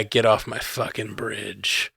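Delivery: a hint of a stern captain; Picard impression.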